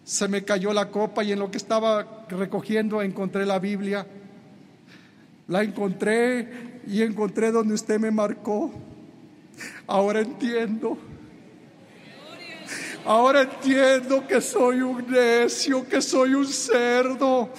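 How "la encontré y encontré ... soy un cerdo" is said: slow, heavy realisation; remorseful confession. Retold or imitated. Repentant man to preacher, slow remorseful. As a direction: let the words fall in remorse.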